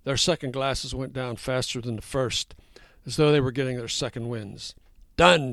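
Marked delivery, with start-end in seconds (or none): 5.2-5.5 loud triumphant shout. A narrator's reading. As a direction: shout it out, done. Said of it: "shouted Danny".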